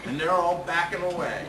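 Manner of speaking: usual speaking voice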